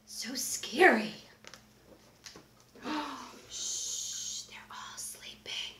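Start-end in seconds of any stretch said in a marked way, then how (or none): none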